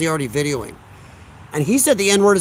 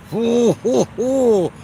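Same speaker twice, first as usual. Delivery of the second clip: mock owl hoots, pitched up; playful hooting; 5 semitones higher, much slower than usual. Streamer greets the chat with playful owl hoots.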